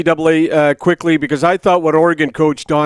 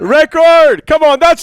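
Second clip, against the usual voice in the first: high, loud, emphatic retort; insistent shout; 9 semitones higher, 6 dB louder than usual. Real, not acted. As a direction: shout the word back to win the argument. Heated joking argument over pronunciation.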